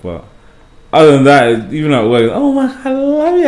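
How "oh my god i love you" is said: very high-pitched mock gushing. Imitating women at work fawning over the accent, gushing. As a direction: imitate an adoring older woman in a high squeal.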